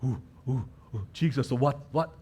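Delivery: hushed, eager murmuring; soft and low, as if in a huddle; conspiratorial hush among followers; huddled disciples. Acted. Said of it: "they were huddling".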